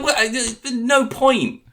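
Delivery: very high, loud, slow disbelief; can't believe the question; incredulous. Retold or imitated. Incredulous at six nuggets.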